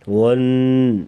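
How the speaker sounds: ون with the n held long as a nasal hum; ghunna. Shown as a demonstration. Teacher shows the ghunna rule, holding the n of ون in a hum. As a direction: hum and hold the doubled n of ون. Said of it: "نون اور میم کے اوپر شد ہوگی تو ہمیشہ غنہ".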